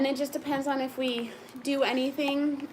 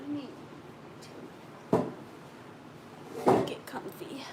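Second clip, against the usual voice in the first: slow soft whisper; 2 semitones higher, 3 dB quieter, much slower than usual. Real, not acted. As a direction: breathe the words out slowly. Settling in on the live stream.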